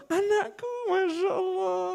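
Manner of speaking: very high, soft cooing voice; doting cooing father. Retold or imitated. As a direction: coo at the child on a video call. (suara ayah yang sangat tinggi dan lembut, menimang anak)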